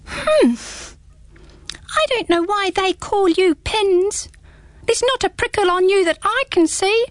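squeaky small creature, teasing; little hedgehog voice. Acted. Hedgehog speaks in story.

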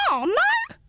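very high hedgehog squeal; high indignant hedgehog squeal. Acted. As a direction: squeal it back, tiny and cheeky. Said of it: "squealed the hedgehog".

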